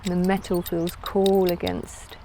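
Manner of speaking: calm, unhurried guided meditation voice. Acted. Yoga teacher leading listeners into a floating visualisation, calm meditative delivery. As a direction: guide them inward with long pauses between phrases.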